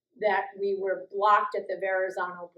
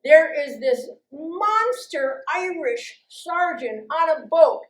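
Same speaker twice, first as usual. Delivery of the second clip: ordinary talk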